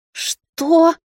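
mother hit by the news, shocked; shocked what. A narrator's reading. (потрясённое «Что?»)

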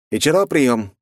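calling out over the radio. A narrator's reading. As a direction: hail him on comms. Radio call from Neptune.

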